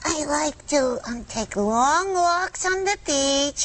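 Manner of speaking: high, slow puppet voice; sassy little lamb voice; Lamb Chop voice. Pretend.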